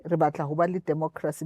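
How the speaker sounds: normal talking voice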